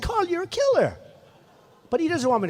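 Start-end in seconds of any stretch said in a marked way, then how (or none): 0.0-1.0 teasing. Retold or imitated. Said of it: "I said".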